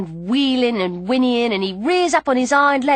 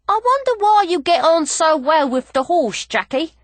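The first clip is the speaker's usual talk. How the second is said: higher, lightly voiced puzzled jockey; wondering Cockney jockey; 5 semitones higher than usual. A narrator's reading. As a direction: wonder aloud, pitched up. Jockey wonders aloud to the girl.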